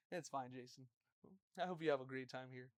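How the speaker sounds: low, soft imitation of a dad; restrained dad voice. Friend's dad excusing the visitor, low and quiet. Yelling dad turns gentle with guest.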